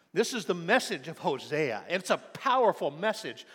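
fervent sermon voice pleading with a people; preaching at full force. Acted. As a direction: proclaim it like the pulpit climax. Sermon climax continues.

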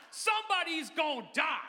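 high, loud dramatic call; big booming prompt for the crowd; said with your chest. Shown as a demonstration. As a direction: shout it out like a city preacher. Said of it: "one more time".

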